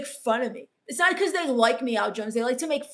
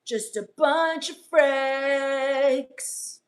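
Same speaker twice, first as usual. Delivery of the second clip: slow creepy drawl; much slower than usual. Acted. Streamer's slow creepy vampire persona.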